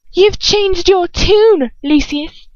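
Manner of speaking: high, slow, sneering snap; snapping Bellatrix. A narrator's reading. Bellatrix snaps back at Lucius, high and sneering. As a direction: bite back at him, mocking. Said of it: "Bellatrix snaps back".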